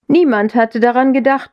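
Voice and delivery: ordinary delivery, nothing marked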